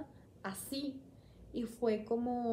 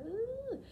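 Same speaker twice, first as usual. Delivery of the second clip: disgusted ugh; 12 semitones higher, 4 dB quieter than usual. Retold or imitated. Recalling her disgust at newborns.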